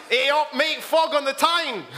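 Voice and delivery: high-pitched, hammed-up Geordie shout; Geordie accent. Geordie greets a mate in Kroger, broad Geordie accent.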